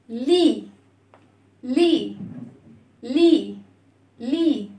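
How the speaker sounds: لي as a long "lee"; broken vowel. Shown as a demonstration. Kasra lets the letter hear her sound, long "lee" لي. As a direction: stretch لي on a clear "ee" vowel. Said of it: "هنكسر الصوت".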